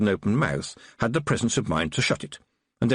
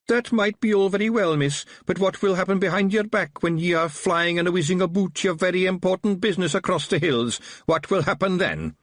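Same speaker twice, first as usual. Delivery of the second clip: higher-pitched Scottish little fighting man; Wee Mad Arthur; 10 semitones higher than usual. A narrator's reading. Small Feagle policeman to Tiffany, higher Scottish brogue.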